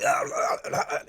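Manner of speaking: strangled, croaking "ah" noises; imitated voice spasm. Explaining how his voice sounds on podcasts, imitated voice spasm. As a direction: mimic a spasming voice on repeated ah. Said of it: "it sounds like that the entire time".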